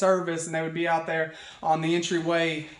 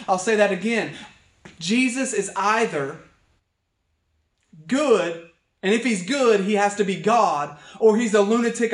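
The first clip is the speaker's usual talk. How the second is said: raised preaching voice, punching the key words; emphatic preaching; 3 semitones higher, 4 dB louder than usual. Acted. Pastor argues to the congregation that Jesus is God, emphatic preaching. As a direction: drive the point home from the pulpit.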